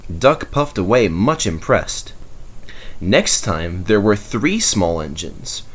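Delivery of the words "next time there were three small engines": usual speaking voice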